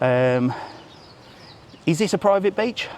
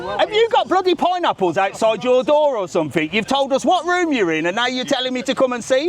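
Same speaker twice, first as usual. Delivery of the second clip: higher, playful mock outrage; teasing disbelief; 7 semitones higher than usual. Real, not acted. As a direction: tease him for sharing his room. Teasing man about his room.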